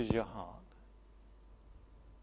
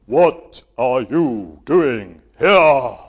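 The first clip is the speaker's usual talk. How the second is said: huge booming shout, slow; film God booming; 14 dB louder, much slower than usual. Shown as a demonstration. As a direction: boom it out like a movie God. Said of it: "But he doesn't say that".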